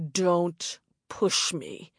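low, slow menacing growl; man's growl. A narrator's reading. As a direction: threaten her coldly. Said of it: "the man growled".

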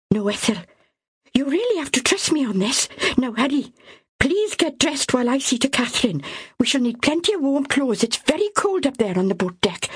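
high, firm, pressing; urgent insistence. Acted. Myra urging Ethel to dress warmly, insistent. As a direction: make her listen this time.

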